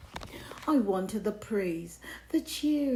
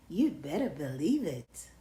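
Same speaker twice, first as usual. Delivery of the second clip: plain reading voice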